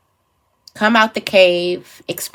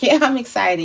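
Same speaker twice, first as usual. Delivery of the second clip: mock half-hearted excitement, slightly high; unconvincingly excited; 6 semitones higher than usual. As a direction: imitate someone claiming excitement without meaning it. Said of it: "You don't seem excited".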